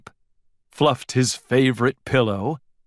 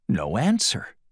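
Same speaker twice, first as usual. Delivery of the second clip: ordinary delivery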